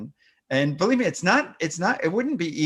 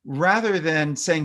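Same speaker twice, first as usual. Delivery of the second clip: normal talking voice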